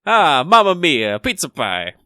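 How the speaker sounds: hammed-up Italian accent, slow; mock Italian chef exclaiming; jolly italian pizza chef.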